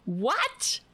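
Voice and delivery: ordinary talk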